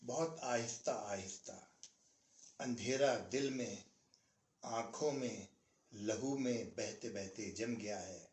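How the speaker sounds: slow, measured nazm reading with long pauses; poem recitation. Acted. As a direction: recite each line like a mushaira verse, letting the ends sink. Recites Iftikhar Arif nazm. (धीमी, ठहरी हुई नज़्म पढ़ाई)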